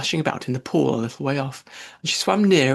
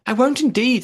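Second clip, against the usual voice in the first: high, loud hasty promise; hurried promise; 10 semitones higher, 6 dB louder than usual. A narrator's reading. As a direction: rush out the promise. Alice in a great hurry.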